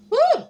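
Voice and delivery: sudden high, loud woo; bright high exclamation; high whoop. Real, not acted. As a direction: whoop to shake it off. Shaking off a yawn.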